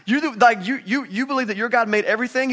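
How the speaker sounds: high, loud frightened disbelief; terrified sailors. Retold or imitated. Sailors horrified at Jonah's God, high frightened cry.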